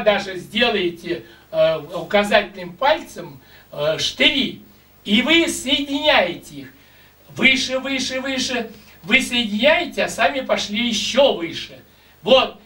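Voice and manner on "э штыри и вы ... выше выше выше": usual speaking voice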